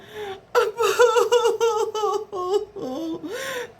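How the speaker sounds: high, drawn-out boo-hoo sob; mock crying wail. A narrator's reading. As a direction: wail like a storybook bird, hammed-up. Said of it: "cried Bird".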